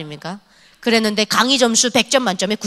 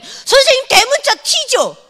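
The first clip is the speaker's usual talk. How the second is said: higher, slow teasing student voice; teasing kid; 13 semitones higher, 3 dB louder, slower than usual. Retold or imitated. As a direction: drag it out to tease the teacher. Kids tease the teacher. (선생님을 놀리는 중학생의 높고 느린 말투)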